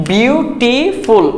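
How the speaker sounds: বিউটিফুল very slow, a gap between its three syllables. Shown as a demonstration. Teacher proving বিউটিফুল has three syllables, slowly syllable by syllable. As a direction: count out বিউটিফুল in three stretched beats. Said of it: "এখানে তিনটা syllable আছে".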